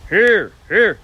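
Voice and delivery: loud, supported calls of "here"; loud lung-powered "here". Shown as a demonstration. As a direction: boom "here" from the lungs. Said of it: "way the voice sounds".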